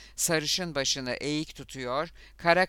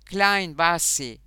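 crowd shouting; 4 semitones higher, 6 dB louder than usual. A narrator's reading. Crew egging on their boy in the fight, shouting. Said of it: "diye bağırdılar".

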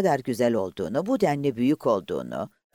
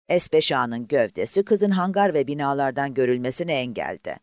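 plain reading voice

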